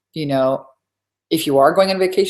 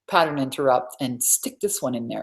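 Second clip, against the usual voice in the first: ordinary delivery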